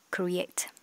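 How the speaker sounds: "Create" ending on a clear final t; ending sound demo. Shown as a demonstration. Teacher models the final t of "Create". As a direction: close "Create" with a crisp t. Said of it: "chúng ta có âm T ở cuối".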